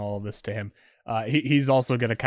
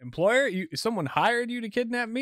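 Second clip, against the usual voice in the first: captive cop, a bit higher and placating; nervous cop; 9 semitones higher, 3 dB louder than usual. Acted. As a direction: soothe the kidnapper, rising okays. GM voices cop questioning.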